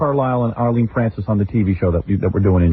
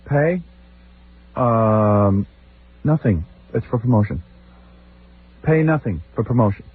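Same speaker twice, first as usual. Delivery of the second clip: grunted one-word replies; 4 semitones lower, slower than usual. Retold or imitated. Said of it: "So I start giving one-word answers".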